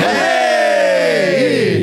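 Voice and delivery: long drawn-out shouted cheer; excited greeting. Real, not acted. Host hails the returning caller, excited shout. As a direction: greet the caller with a high, happy holler.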